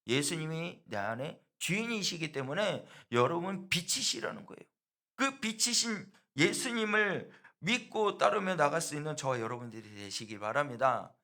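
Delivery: loud, rising preacher's cry, slowed at the peak; full-force preaching. Acted. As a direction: preach it out to the whole church. Preacher building to a climax.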